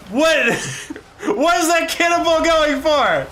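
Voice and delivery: high, loud yell breaking into laughter; laughing disbelief. Real, not acted. Streamer watching the cannonball go astray, laughing disbelief. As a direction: shout it helplessly through real laughter. Cracking up at game bug.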